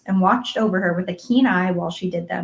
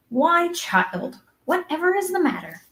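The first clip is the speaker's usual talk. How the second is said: high, taken-aback question; surprised older guardian, pitched up; startled concern; 9 semitones higher than usual. A narrator's reading. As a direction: exclaim in puzzled alarm at a child. Marilla startled by Anne crying.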